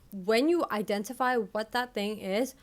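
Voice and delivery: ordinary delivery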